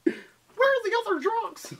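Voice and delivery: high, slow, laughing voice; squeaky send-up of the line; high silly voice. Acted. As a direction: stretch it out high and goofy.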